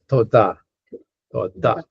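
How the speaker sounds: toda with the stress on "da"; teacher modelling toda, end-stressed; last-syllable accent. Shown as a demonstration. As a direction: say toda carefully, leaning on the final syllable. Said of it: "it's accented on the last syllable".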